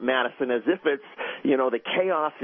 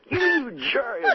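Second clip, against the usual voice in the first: high-pitched retold indignation; exasperated squawk, played for laughs; mock outraged reaction; 14 semitones higher than usual. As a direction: imitate a prank victim's outburst. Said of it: "and he's like".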